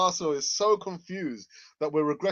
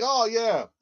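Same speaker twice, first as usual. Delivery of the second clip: mock young fan; 6 semitones higher, 9 dB louder than usual. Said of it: "you probably think".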